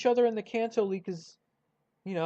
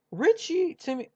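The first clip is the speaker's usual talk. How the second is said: voice crack; 8 semitones higher, 3 dB louder than usual. Real, not acted. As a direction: voice cracking on "Richie to me". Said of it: "voice crack".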